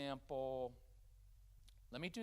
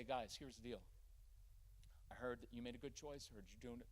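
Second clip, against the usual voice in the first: softer, lower, settled praise; calm parent; 3 semitones lower, 9 dB quieter than usual. Shown as a demonstration. Parent greeting kids after work, calm and soft. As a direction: speak gently from a place of control. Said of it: "you come into the home and you say".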